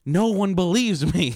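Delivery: mock exasperated cry, high and drawn out; aggrieved protest. Ghost hunter insisting to doubters, mock aggrieved.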